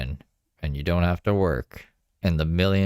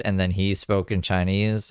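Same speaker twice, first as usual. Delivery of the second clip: ordinary talk, nothing marked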